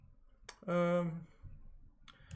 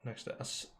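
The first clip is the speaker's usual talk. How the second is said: ordinary talk, nothing marked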